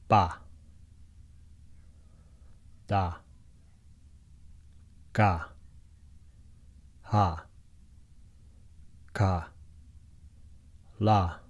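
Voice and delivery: slow, separated syllables "Ba", "Da", "Ga", each alone; syllable drill. Shown as a demonstration. Teacher reading out each syllable of the script. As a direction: read the syllables one by one, spaced out. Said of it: "it's ba".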